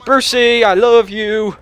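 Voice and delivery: ordinary talk, nothing marked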